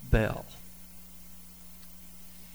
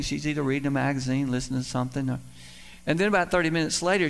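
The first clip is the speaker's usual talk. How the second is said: usual speaking voice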